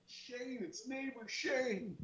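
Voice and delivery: very high, hushed mock yell; retold yelling. Neighbor yelling over the dogs to name himself, hushed high mock yell. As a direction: imitate a yell at a fraction of the volume, slow and high. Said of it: "So I'm yelling more".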